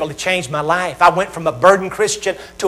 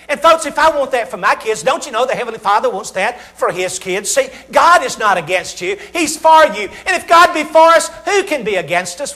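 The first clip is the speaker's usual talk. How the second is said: fiery preaching; 5 semitones higher than usual. Real, not acted. Preacher rousing the congregation, climbing to a shout. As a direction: build the sermon to a rousing height.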